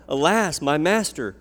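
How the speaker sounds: loud, high anguished cry. A narrator's reading. Woodcutter crying to Elisha over the lost axe head, loud dismay. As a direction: wail it out in dismay. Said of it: "he cried out".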